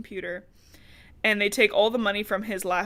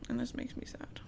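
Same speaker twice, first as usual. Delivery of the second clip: whispered aside; 3 semitones higher, 14 dB quieter than usual. Real, not acted. Aside during emotional scene.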